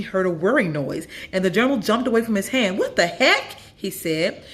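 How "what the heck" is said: high, louder startled cry; high surprised boy voice. Acted. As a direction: caught off guard. Boy startled by moving journal.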